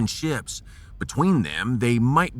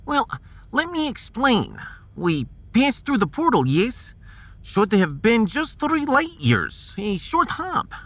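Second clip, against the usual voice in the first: put-on a higher-pitched Russian-accented scientist, patient and precise; 10 semitones higher than usual. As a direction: play the Russian science officer calmly explaining the stars. Russian officer explains the portal.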